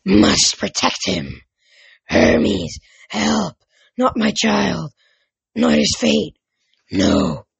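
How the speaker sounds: forceful, possessed rasping voice; deep rasp. A narrator's reading. Possessed mother pleading against her son's fate, deep rasp. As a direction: rasp each phrase out harshly, in bursts. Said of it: "she rasped in a much deeper voice".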